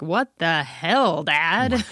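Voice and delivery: slow mock bewildered "What the hell, Dad?"; stepkid confused by accent. Stepkid baffled by his Southern twang, slow mock bewilderment. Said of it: "they're gonna be like".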